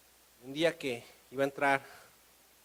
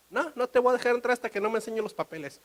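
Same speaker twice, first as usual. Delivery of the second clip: raised, firm refusal; firm official; 8 semitones higher, 5 dB louder, faster than usual. Acted. Customs officer to painter without papers, firm refusal. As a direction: refuse in a higher, louder official voice.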